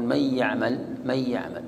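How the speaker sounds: من يعمل as mayy-ya'mal with a nasal hum; incomplete idgham. Shown as a demonstration. Teacher shows partial merging with ghunna, من يعمل joined. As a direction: merge the n of من into a held y of يعمل. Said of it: "فتدغم إدغاما ناقصا".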